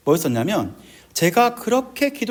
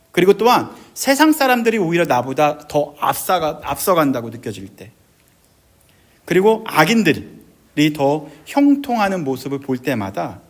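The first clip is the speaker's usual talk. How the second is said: ordinary talk, nothing marked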